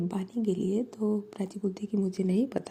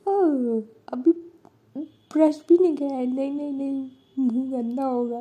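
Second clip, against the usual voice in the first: shy playful protest; 5 semitones higher, 6 dB louder than usual. Retold or imitated. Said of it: "और बोलती है".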